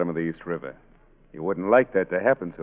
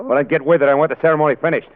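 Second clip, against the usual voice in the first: barking orders; 7 semitones higher, 6 dB louder than usual. Acted. Gangster bullies bride at wedding.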